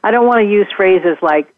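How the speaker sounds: ordinary delivery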